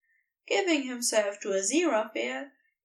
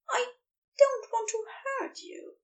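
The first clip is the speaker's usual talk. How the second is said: higher angel voice, hesitant; Aziraphale's voice; 13 semitones higher than usual. Acted. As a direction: lift into the angel's voice, unsure. Aziraphale voiced, answering Crowley.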